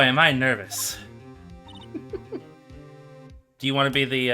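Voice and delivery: ordinary talk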